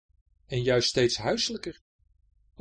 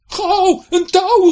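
high, loud desperate shout; calling for a rope; 16 dB louder, much slower than usual. Acted. Second pirate shouting for a rope, loud panic. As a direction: yell for help in a hurry. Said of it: "riep de tweede kaper".

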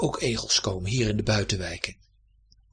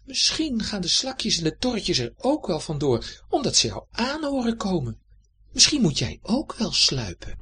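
much higher, musing cat; 8 semitones higher than usual. A narrator's reading. Cat pondering the hedgehog's problem, high voice. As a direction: think aloud as the clever cat. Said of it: "zei hij".

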